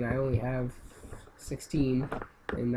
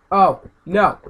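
loud repeated wailing no; despairing yells; 4 semitones higher, 12 dB louder, much slower than usual. Real, not acted. Gamer losing lives on hated level, loud wails. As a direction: wail no over and over.

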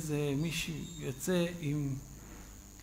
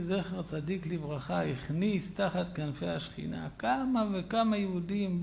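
usual speaking voice

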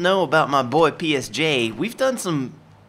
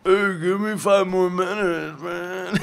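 sleepy character voice; 5 semitones higher than usual. Pretend.

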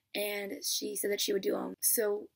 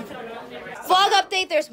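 excited; 10 semitones higher, 6 dB louder, much slower than usual. Real, not acted. Excited slow vlog announcement.

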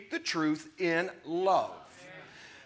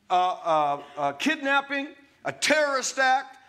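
ordinary talk, nothing marked

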